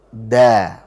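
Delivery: Da with the tongue at the base of the upper teeth; correct dal. Shown as a demonstration. Teacher modelling the correct dal for a student. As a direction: release the held t into Da. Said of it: "baru ucapkan dal berharokat".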